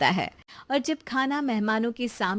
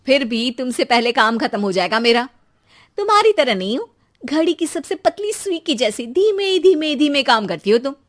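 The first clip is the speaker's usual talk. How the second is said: louder, higher taunting retort; heated boast; 3 semitones higher, 6 dB louder than usual. Acted. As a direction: snap back proudly, voice rising. Boastful quarrel between sisters-in-law.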